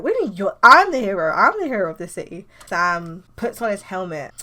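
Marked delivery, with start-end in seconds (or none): 0.0-2.5 indignant, loud burst on the claim; mock-outraged rival hero; angry. Sam shouting off panel at Lars, angry. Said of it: "He's, like, angry".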